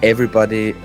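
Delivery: usual speaking voice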